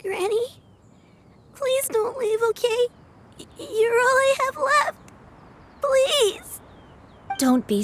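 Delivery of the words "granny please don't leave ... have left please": high, pleading, close to tears; tearful pleading. Acted. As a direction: beg her not to leave. Girl begging dying granny.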